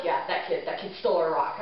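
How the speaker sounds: low, flat dad voice; dry deadpan dad impression; imitating dad. Telling about the stolen rock, lightly voiced dad. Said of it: "my dad's like".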